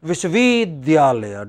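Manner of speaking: "Vishwavidyalaya" with "vid" heavily stretched; exaggerated stress. Shown as a demonstration. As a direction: overstress "vid" inside "Vishwavidyalaya". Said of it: "they stress. We don't say".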